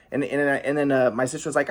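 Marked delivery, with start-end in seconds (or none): none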